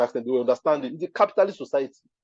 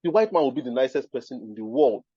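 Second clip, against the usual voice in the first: ordinary talk, nothing marked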